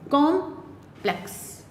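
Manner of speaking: Complex slowly in two parts, कॉम, pause, plex; syllable by syllable. Shown as a demonstration. Teacher showing two vowel sounds, complex split after कॉम. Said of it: "यहाँ पर दो vowel sound है".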